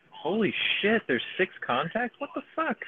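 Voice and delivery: startled, pitch leaping high; real surprise. Real, not acted. Player spotting six contacts at once, surprised. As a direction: blurt it in high-pitched disbelief.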